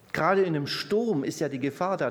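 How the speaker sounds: ordinary talk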